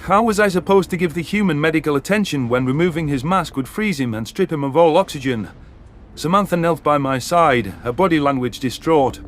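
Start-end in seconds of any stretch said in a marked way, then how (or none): none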